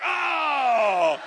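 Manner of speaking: sudden high loud Oh; startled outburst. Retold or imitated. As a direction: jolt like caught out. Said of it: "I was like".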